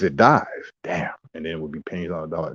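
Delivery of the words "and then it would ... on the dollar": fast hushed aside; quick whispered aside. Real, not acted. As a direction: toss it off under your breath.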